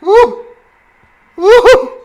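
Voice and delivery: loud, very high "woo"; celebratory whooping. Real, not acted. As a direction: whoop twice at a big play. Celebrates a big play.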